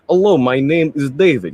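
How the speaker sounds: light Russian accent demo. Shown as a demonstration. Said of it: "like, a Russian accent".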